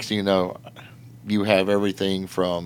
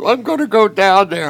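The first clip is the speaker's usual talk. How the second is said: higher, louder mock blubbering; imitating crying drunk; 13 semitones higher, 9 dB louder than usual. Drunk man giving in to the rider, hammed-up blubbering. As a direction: whimper it out like a beaten drunk. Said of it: "he starts crying".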